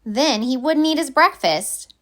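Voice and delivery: ordinary delivery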